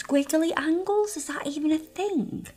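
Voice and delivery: high, questioning self-echo; doubting herself. Real, not acted. Nail tech doubting the name of her design, high and questioning.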